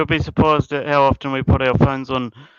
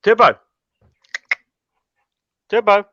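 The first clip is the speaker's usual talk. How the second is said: high, loud call across the house; calling the dog; 8 semitones higher, 5 dB louder than usual. Real, not acted. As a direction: call her name down the hall. Calling the dog's name.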